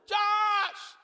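a proud dad shouting across the water; excited shout. Retold or imitated.

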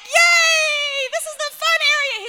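high, loud hurrah; over-the-top squealing delight; gleeful cheer. Retold or imitated. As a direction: cheer a child's return at full voice. Family cheers the son's return.

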